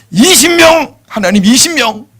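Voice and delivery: high and loud; eager bid. Acted. Abraham daring to ask twenty, high and loud. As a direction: go for broke.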